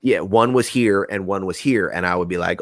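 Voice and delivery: ordinary talk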